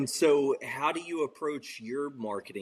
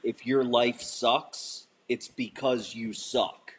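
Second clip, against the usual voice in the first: slow, punchy quote of a blunt speaker; slow, in-your-face seminar line; slower than usual. Retold or imitated. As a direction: lay it down slowly, word by word. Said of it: "is basically like".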